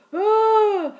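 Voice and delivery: loud high crying "oh" wail; mock howl. Imitating a spanked kid's loud crying wail. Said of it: "we kind of heard the".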